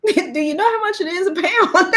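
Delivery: high, laughing retold question; cracking up. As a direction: laugh through the clerk's question. Cracks up quoting the clerk.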